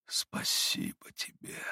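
тихо, медленно, на выдохе; выдохнуть слова после обморока; протяжный выдох. A narrator's reading. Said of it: "выговорил он протяжно, точно испуская вздох". (Митя тихо и протяжно выдыхает благодарность)

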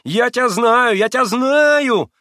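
high, loud, slow insistent shouting; stubborn foolish repeating. Acted. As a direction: harp on it like a fool. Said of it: "заладил, как дурак".

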